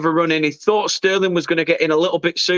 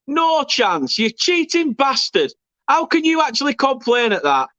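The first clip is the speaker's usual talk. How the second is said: indignant; 7 semitones higher than usual. Real, not acted. Angry at complaining opponents.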